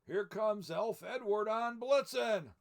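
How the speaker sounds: loud, high, slow announcement; shouting. Acted. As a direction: call it out across the workshop. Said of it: "Missus Claus shouted from the window".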